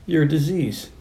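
soft tough-cop catchphrase; Cobra, understated. Retold or imitated. Cop sizing up a psycho, quiet tough line.

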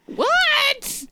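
very high squealed reaction; high, astonished squeal; shocked disbelief. Real, not acted.

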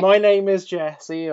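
slow, loud, lightly put-on Cockney; playful demo of a Cockney accent, drawn out. Shown as a demonstration. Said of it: "do a bit of a Cockney accent".

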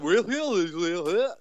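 put-on high, loud parody of [name]'s off-key accent; mock off-key Iggy accent. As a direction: mimic her fake accent, repeating the word. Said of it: "With an off-key accent as well".

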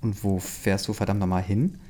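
ordinary delivery

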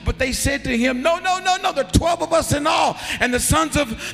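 loud, high, driving preacher voice; preaching in full force. Acted. Preacher retelling the brothers' plea, loud and high. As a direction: shout the scripture out to the church.

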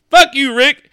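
high, loud retort; hammed-up loud insult; defiant mock yell. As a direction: throw it back at him defiantly. Acted standoff with Rick.

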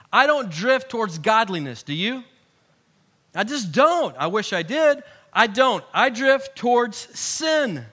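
ordinary talk

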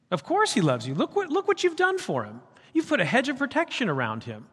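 sneering, high mocking accuser; mocking Satan. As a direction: taunt God like a sly accuser. Satan voiced mocking God.

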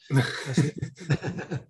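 slow, laughing wrap-up; real laugh on the words. Real, not acted. Laughs about choking talented players.

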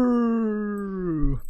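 long, high mock wail; mock scream. Vader learning that he killed Padme, mock scream. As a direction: stretch out a hammed-up cry, no real volume. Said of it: "and screams out".

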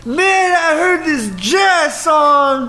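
high, slow put-on voice of the rambling clerk; imitating jazz-loving clerk. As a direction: drag it out high and slow, like a man lost in his jazz story. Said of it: "He's like".

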